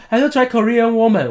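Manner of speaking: high-pitched imitation of accented English; heavy taxi-driver accent. Korean taxi driver repeats his question, high accented English. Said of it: "他口音有点重".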